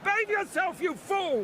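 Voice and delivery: high scolding yell; scolding shout. Real, not acted. Commentator scolding crowd.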